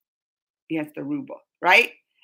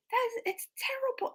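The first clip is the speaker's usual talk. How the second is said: dismayed whisper, higher; 11 semitones higher, 9 dB quieter than usual. Real, not acted. Reacting to a chat comment about war, in a dismayed whisper. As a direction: breathe it out in quiet disapproval.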